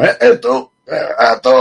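mock yell, high and loud; imitating the Penguin shouting. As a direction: play the crazed villain barking out. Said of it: "sale así como". (imita al Pingüino gritando, agudo)

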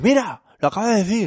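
put-on high child's voice, insisting; imitating her daughter. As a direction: pitch up like a little girl tattling.

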